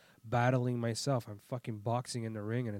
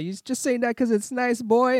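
high, loud put-on voice; shouted comeback; 12 semitones higher, 11 dB louder than usual. Retelling retort to driver, hammed-up shout. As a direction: fling it back loudly, hammed up.

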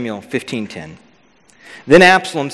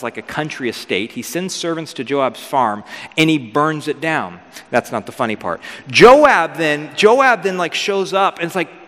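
ordinary delivery

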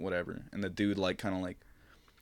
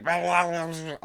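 muffled blah; 10 semitones higher, 5 dB louder than usual. Retold or imitated. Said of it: "I couldn't hear anything".

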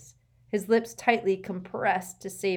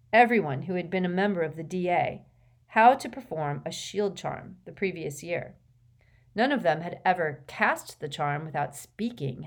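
ordinary delivery

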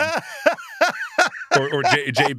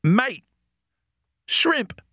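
one-word Australian accent, pitched up; 9 semitones higher than usual. Shown as a demonstration. Co-host shows off one word Australian accents, Australian accent. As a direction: toss out single words in an Australian accent. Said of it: "one word Australian accents".

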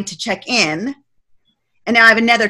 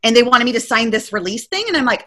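ordinary delivery

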